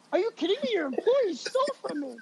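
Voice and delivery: high, incredulous protest; indignant disbelief. Retold or imitated. Protesting to the security guard.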